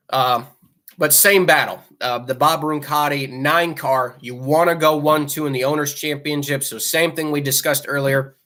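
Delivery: usual speaking voice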